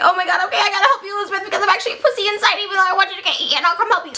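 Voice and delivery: high, rushed, flustered giving-in; mock fretting. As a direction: babble it in a hammed-up fluster. Frankenstein frets, gives in.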